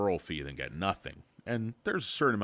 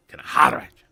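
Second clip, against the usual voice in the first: holler; 7 semitones higher, 14 dB louder, much faster than usual. Acted. Calling out to the chat.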